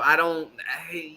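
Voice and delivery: ordinary talk, nothing marked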